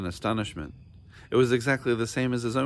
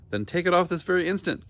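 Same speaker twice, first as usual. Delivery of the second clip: higher, louder royal order; louder command; 9 semitones higher, 3 dB louder, faster than usual. A narrator's reading. King ordering the hat off, higher and louder. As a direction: demand it right now. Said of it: "more loudly than before".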